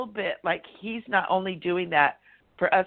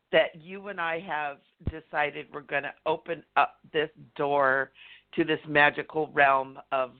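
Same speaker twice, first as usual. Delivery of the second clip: ordinary talk, nothing marked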